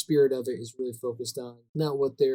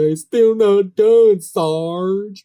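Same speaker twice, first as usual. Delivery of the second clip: loud, drawn-out, hammed-up Southern bumpkin; Gomer Pyle; 5 semitones higher, 8 dB louder, much slower than usual. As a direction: ham up the dopey private calling his sergeant, big and slow. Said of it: "he extra hams up his Gomer Pyle".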